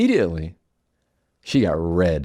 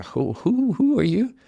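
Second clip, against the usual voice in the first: high, startled question; taken aback; 10 semitones higher than usual. Retold or imitated. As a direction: caught off guard, asking who spoke. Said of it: "being just taken aback".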